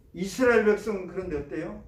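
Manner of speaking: normal talking voice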